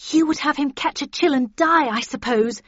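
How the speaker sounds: high, loud retort; defiant. A narrator's reading. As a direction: answer back sharply to your mother. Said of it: "replied the younger Catherine defiantly".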